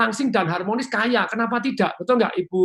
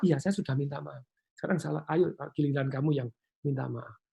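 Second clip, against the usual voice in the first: calm reply; 4 semitones lower, 7 dB quieter than usual. Shown as a demonstration. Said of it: "dengan nada yang tepat".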